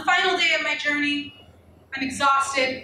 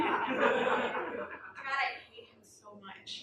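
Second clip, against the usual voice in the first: ordinary talk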